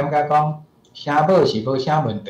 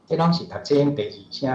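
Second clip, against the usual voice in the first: ordinary talk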